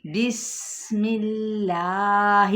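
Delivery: بسم اللہ very slow, with a light, thin lam in اللہ. Shown as a demonstration. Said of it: "کیسے؟".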